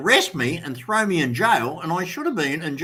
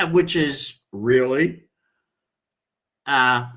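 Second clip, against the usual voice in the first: ordinary talk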